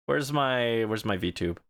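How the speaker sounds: ordinary talk, nothing marked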